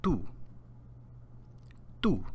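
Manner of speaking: Tú said alone with a pure u vowel; Spanish tú. Shown as a demonstration. Teacher says Tú for students to repeat. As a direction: model Tú clearly. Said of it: "repeat after me".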